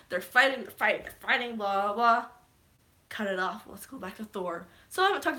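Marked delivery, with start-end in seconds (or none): none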